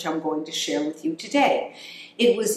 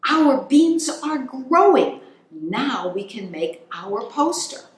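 excited; 6 semitones higher, 3 dB louder than usual. Acted. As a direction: burst out like a hamster whose beans grew. Hamsters see sprouts.

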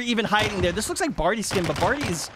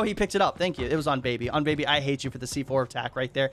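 normal talking voice

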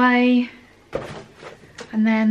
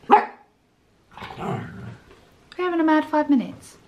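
ordinary talk

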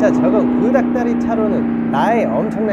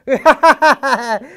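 villain cackle; 5 semitones higher, 6 dB louder than usual. Acted. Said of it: "도둑 해피".

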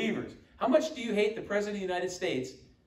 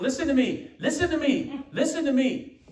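higher, louder pleading preacher repeats; imitating a preaching pastor; 7 semitones higher, 6 dB louder than usual. Preacher mimics his pastor friend to the church, higher and louder. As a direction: ham up a pastor begging the crowd to listen. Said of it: "he'd be preaching his heart out".

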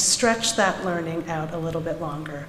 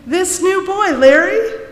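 mocking teacher; 11 semitones higher, 4 dB louder than usual. A narrator's reading. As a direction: tease him in front of the class, pitched high. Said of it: "with a grin on her face".

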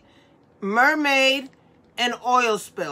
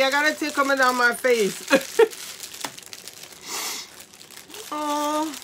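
ordinary delivery

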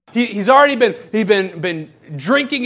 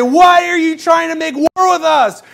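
alarmed question; 8 semitones higher, 4 dB louder than usual. Retold or imitated. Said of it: "the Israelites come out and say".

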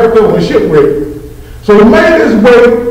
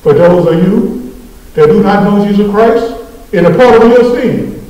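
ordinary talk

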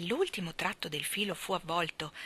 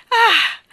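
high, loud "ah" of triumph; sudden full-voiced cry of triumph; triumphant cry; 15 dB louder than usual. A narrator's reading. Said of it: "gettò un grido di trionfo". (grido acuto di trionfo)

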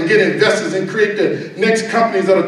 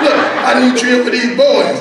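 mock pleading, pitched up high; insistent wife; 6 semitones higher than usual. Wife begging her traveling husband to stay, imitated high insistence.

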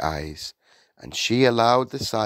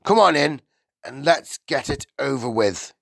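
higher, grudging irritation; annoyed, raised-pitch concession; snapped; 7 semitones higher, 5 dB louder than usual. A narrator's reading. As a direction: wave the visitor in impatiently. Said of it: "he snapped".

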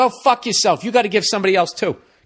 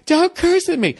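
higher, mock offended wife; 11 semitones higher than usual. Wife scolding her husband for cursing, raised mock voice. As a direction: play the wife snapping back. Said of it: "acted quarrel, wife's line".

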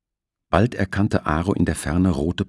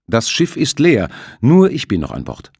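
firm; 6 semitones higher, 6 dB louder than usual. Acted. Captain answers.

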